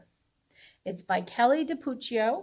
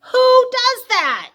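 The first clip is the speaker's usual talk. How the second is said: much higher and louder, a big outraged gasp of a question; mock outrage; 13 semitones higher, 13 dB louder than usual. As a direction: play the shock up big for the kids. Reader mock-outraged at dragon.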